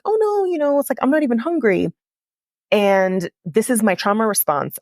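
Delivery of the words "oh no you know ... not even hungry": much higher, airy brush-off; high "oh no" easing down through the excuse; high-pitched protest. Retold or imitated. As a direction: insist lightly that you're fine, pitched way up. Brushing off advice to eat.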